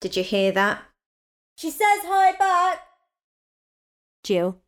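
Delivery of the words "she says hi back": passing on a greeting from the loo by shouting; shouting across the house. Real, not acted. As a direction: shout it so he hears through the door. Shouts message to husband.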